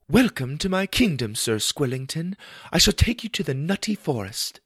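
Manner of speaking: high regal princess voice; sweet fairy tale princess. Acted. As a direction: play a grand little princess. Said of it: "in her princess voice".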